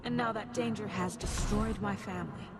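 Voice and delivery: low, heavy confession of guilt; grim self-blame. Acted. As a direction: sink your voice low as you blame yourself. Self-accusing inner voice confesses guilt.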